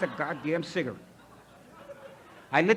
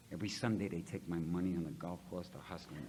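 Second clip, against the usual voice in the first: normal talking voice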